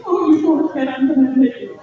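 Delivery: mock-sobbing sympathy; mock weepy reader. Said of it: "so she'll be like".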